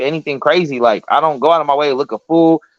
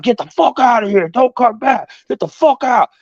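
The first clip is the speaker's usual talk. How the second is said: high-pitched mock yelling; heated orders at raised pitch; retold angry boss; 7 semitones higher than usual. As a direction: act out a boss throwing someone out.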